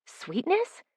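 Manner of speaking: soft, high questioning lilt; puzzled surprise. A narrator's reading. She finds no smugness in his eyes, soft puzzled surprise. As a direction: say it softly, pitch rising in disbelief. Expected smugness, found sweetness.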